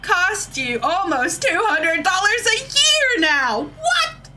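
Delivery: high-pitched, indignant rant with stretched-out words; exasperated outburst. Real, not acted. Ranting to chat about subscription prices, high exasperated outburst.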